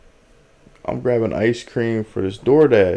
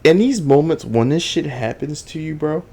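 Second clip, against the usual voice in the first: normal talking voice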